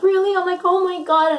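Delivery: high, loud, amazed question; retold squeal of happy surprise; ecstatic disbelief. Mother-to-be reacting to pregnancy news, overjoyed.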